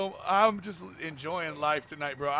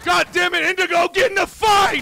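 furious shout, much louder and higher; angry yell; 10 semitones higher, 12 dB louder than usual. Real, not acted. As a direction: bark at the teammate to fight. Yelling at teammate mid-fight.

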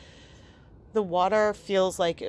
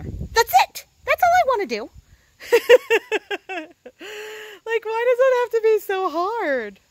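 much higher and louder, laughing in frustration; exasperated outburst; 13 semitones higher, 9 dB louder than usual. Real, not acted. A dog owner fed up with coughing interrupting her filming, exasperated high outburst. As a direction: burst out, fed up and half laughing. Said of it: "I am just so frustrated".

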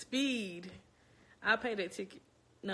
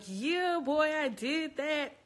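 higher, slow proud boast; mock triumphant; 7 semitones higher, 3 dB louder, much slower than usual. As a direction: crow about nailing it. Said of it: "I'ma be like".

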